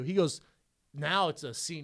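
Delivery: ordinary delivery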